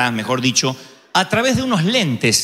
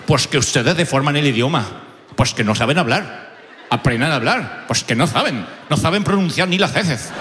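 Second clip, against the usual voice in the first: put-on lower-pitched, scolding Castilian accent with lisped z; Spanish accent from Spain; 6 semitones lower than usual. Spaniards scolding foreigners for deforming the language, low Castilian accent. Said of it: "Todos en España nos pasó igual".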